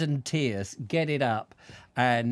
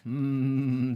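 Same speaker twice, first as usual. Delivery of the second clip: usual speaking voice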